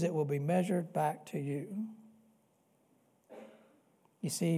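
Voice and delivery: ordinary talk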